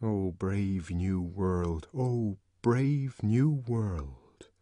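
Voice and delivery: very slow, soft, dreamy line; wondering. A narrator's reading. Savage recalling Miranda's words in wonder. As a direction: breathe the words like a remembered verse.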